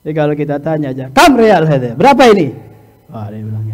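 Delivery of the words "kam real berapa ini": loud, blunt haggling. Shown as a demonstration. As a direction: ask the price hard and loud. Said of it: "berbicara dengan nada keras".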